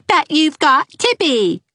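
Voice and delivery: put-on high, slow teasing child echo; high sing-song mockery; mocking echo. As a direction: mimic her back, head wagging. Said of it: "said Geraldine, wagging her head".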